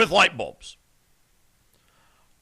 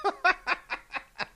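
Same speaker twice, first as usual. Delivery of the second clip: laughing; 9 semitones higher, 8 dB quieter than usual. Acted. Echoes the call, laughing.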